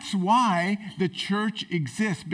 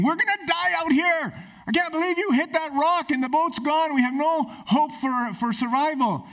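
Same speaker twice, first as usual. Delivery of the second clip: high, frantic panicked cry; panicked yelling castaway; 9 semitones higher than usual. Retold or imitated. Stranded man yelling at the rich man, high and panicked. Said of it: "yelling away at the very rich man".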